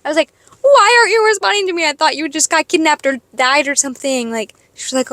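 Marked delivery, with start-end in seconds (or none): 0.6-4.3 high, whiny mock reproach; exasperated worry. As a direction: start high, let the reproach tumble down. Confronting coworker, retold.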